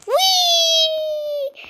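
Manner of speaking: long, high, gleeful whee; excited whee. Real, not acted. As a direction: sing it out like going down a slide. Ragdoll flying in game.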